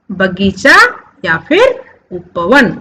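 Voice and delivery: ordinary talk, nothing marked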